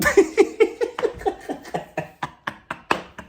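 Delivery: long high breathless laughing fit; can't stop laughing; long airy laugh. Real, not acted. Laughing at "fifteen CEOs.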